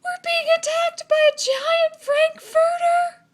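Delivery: very high, loud, slow panicked cry; panicked cats. A narrator's reading. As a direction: flee screaming. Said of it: "cried the cats".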